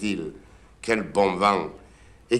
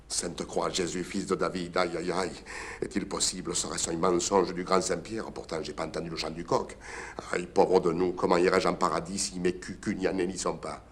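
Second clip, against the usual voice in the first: hushed lament; 7 dB quieter than usual. Acted. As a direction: lament under your breath. Curé lamenting in despair.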